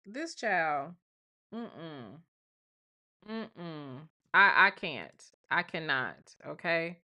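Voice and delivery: ordinary talk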